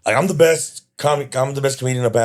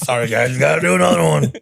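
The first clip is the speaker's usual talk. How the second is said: ordinary delivery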